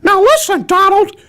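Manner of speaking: very high, loud falsetto; cartoon character voice. Shown as a demonstration. Cartoon character scolding Donald at Disney, high falsetto.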